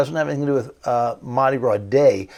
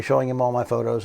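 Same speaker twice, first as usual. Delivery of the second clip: ordinary talk, nothing marked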